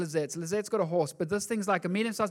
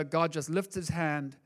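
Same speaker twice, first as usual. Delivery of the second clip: normal talking voice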